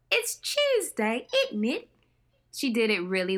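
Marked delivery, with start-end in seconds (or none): 0.1-1.9 put-on Renee's crisp British accent on It's Tuesday, isn't it, slow and high. Host recalling Renee's line, slow high British accent. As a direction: quote Renee's English accent, lifting on Tuesday. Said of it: "Renee had a nice".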